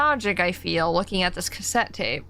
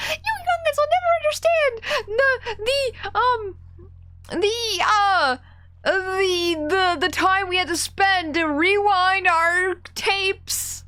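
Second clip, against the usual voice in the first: put-on old grandma voice; 11 semitones higher than usual.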